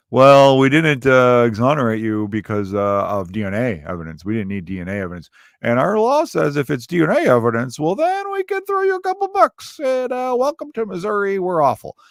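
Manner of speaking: mock state official. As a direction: parody Missouri's government. Mocking Missouri state officials.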